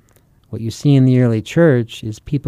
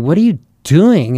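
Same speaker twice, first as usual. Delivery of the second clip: higher, louder outburst as an appalled apostle; acted freak-out; 5 semitones higher, 5 dB louder than usual. Preacher imagines the apostle confronting today's churches, acted freak-out. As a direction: imitate someone freaking out in disbelief. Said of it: "I can imagine him freaking out".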